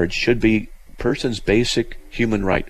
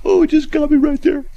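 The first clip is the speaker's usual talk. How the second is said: mock crying; 3 dB louder than usual. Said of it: "I'm gonna start crying here".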